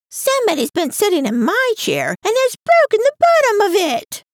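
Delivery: very high, piercing little bear wail; sharp, shrill little bear. A narrator's reading. Little bear finding his chair broken, sharp and shrill. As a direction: squeal it out shrilly as the smallest bear. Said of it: "so sharp and shrill".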